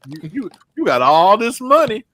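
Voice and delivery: ordinary delivery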